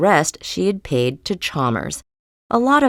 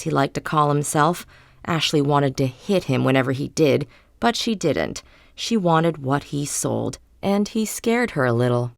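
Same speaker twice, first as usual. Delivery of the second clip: ordinary delivery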